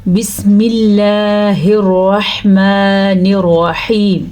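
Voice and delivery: slow recitation with thin اللہ after the kasra; tajweed recitation. Shown as a demonstration. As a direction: recite بسم اللہ slowly, اللہ light and thin. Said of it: "تو چلیں start کرتے ہیں". (بسم اللہ کو آہستہ، اللہ باریک پڑھیں)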